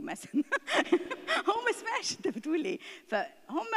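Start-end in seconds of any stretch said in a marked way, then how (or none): 0.5-1.4 laugh. Real, not acted. Laughs at child's answer.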